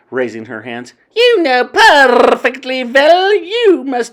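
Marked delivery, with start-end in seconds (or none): 1.2-4.1 high, loud, slow scolding witch voice; stern witch queen. A narrator's reading. Grand High Witch scolding her witches, stern. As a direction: scold them like a tyrant.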